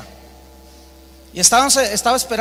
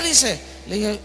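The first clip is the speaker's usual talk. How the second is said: ordinary talk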